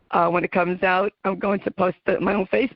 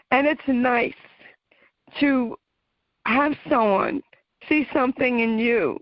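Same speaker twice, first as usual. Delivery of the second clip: touched and grateful, the pitch climbing with feeling; real emotion welling up while thanking mentors; emotional; 5 semitones higher than usual. Real, not acted. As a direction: voice lifted higher, moved and close to tears. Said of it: "oh, I get a little emotional".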